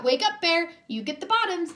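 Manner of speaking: loud, high wake-up call. A narrator's reading. Hare calls sleeping Bear for the second harvest, loud. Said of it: "Hare called out".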